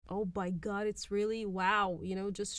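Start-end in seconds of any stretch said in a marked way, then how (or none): none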